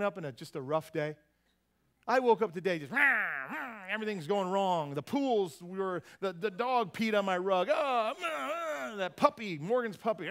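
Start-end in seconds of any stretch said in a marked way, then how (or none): 2.9-3.8 a growled "rah, rah", higher pitched and a bit louder; frustrated grumble. Retold or imitated. Preacher acting out waking up grumpy, mock growl. Grumbling about a rough morning. 7.7-9.0 a much higher, exasperated "ah, ah, ah"; exasperated cry. Retold or imitated. Preacher reliving finding the puppy's mess on the rug, mock exasperated wail.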